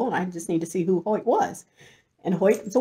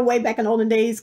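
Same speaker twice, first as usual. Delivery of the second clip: ordinary talk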